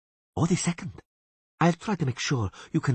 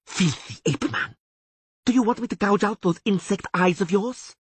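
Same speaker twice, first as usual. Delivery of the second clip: much higher, louder threat; furious elf threat; 4 dB louder than usual. A narrator's reading. Taruviel threatening the Witcher, higher and loud. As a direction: threaten with rage. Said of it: "hissed the elf".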